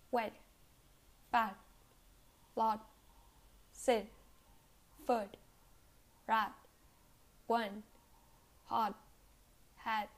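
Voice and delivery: each word stops with no puff on the final T; tongue held on the T, as in "hat"; unreleased final T. Shown as a demonstration. Teacher giving examples, words like "fat" with unreleased T. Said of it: "I don't release air when I pronounce it".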